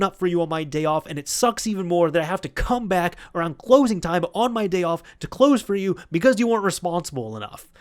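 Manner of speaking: high, loud angry chewing-out; yelling at Steve. Retold or imitated. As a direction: scold him hard for slacking. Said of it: "he just starts yelling at Steve".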